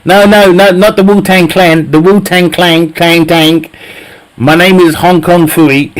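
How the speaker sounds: normal talking voice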